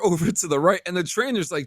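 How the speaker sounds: ordinary talk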